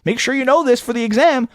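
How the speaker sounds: high, conspiratorial hinting professor; mock winking professor. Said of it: "hanging a lamppost on it".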